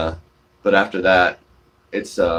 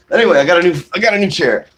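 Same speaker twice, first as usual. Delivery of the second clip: bursts out higher and louder, thrilled; excited about new chair; 8 semitones higher, 7 dB louder, faster than usual. Real, not acted. Showing viewers his new chair, loud and thrilled.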